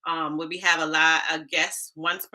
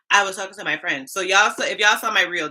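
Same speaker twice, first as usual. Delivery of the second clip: ordinary talk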